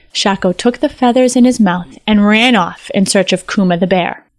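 ordinary delivery, nothing marked

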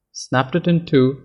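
usual speaking voice

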